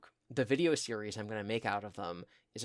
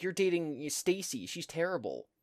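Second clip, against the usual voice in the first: accusing; 7 semitones higher than usual. Retold or imitated. Goth girl to the boy about his new girlfriend, accusing. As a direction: imitate a jealous girl, pitched up and indignant.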